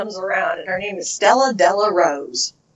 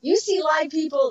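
ordinary delivery

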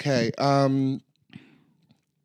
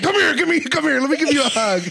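loud, higher hearty uncle voice; big uncle; 8 semitones higher, 4 dB louder, faster than usual. Pretend. Co-host mocking her aggressive hugs, as a loud hearty uncle. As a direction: boom it out like an overeager uncle. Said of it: "You're like".

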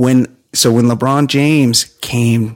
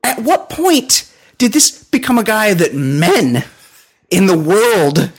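incredulous; 9 semitones higher than usual. Retold or imitated. His own incredulous retort.

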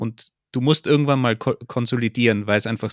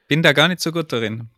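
usual speaking voice

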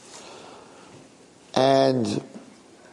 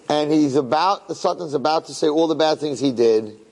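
usual speaking voice

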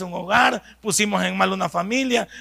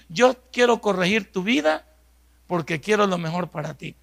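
ordinary talk

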